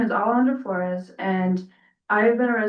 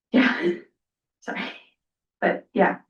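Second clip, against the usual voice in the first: nervous laughter; 4 semitones higher than usual. Real, not acted. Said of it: "I'm a little nervous".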